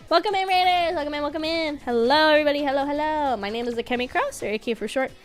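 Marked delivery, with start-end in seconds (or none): 0.1-3.4 cute anime streamer. Real, not acted. Streamer greets raiders.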